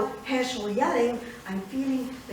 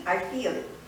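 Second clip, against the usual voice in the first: ordinary talk, nothing marked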